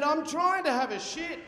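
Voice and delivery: high, laughing retort; a girl snapping back behind the door; mock snappy girl. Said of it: "She said".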